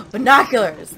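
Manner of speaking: very loud, delighted exclamation; sudden loud cry of discovery; excited shout. Real, not acted. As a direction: burst out loudly at a lucky find. Excited find in the game.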